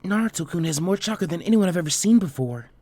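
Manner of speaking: put-on high, timid girl's voice, stammering in awe; a bashful girl stunned by what she sees; shy Hinata.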